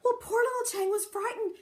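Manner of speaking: ordinary delivery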